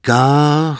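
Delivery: put-on boy tries to read the catechism; halting boy reading. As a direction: sound out the letters. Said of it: "tapaili poika".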